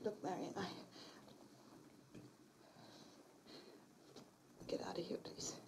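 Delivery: breathless, barely voiced plea; terrified woman begging her friend to leave; frightened whisper. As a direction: whisper it, too scared to speak up. Realizing friend is the caller.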